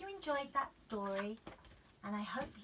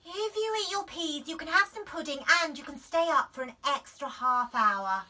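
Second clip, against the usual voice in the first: louder bargaining mum voice; coaxing mum; 6 semitones higher, 8 dB louder than usual. A narrator's reading. Mum raising the bribe, coaxing. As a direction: sweeten the offer. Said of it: "said Daisy".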